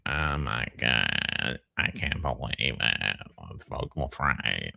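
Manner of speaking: lightly mocking the LA vocal fry. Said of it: "That's a real L A thing".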